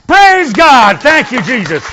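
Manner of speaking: loud, high cry of praise; shouted praise. Real, not acted. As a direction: shout thanks to God at full force. Shouted praise after congregational declaration.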